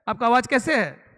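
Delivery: usual speaking voice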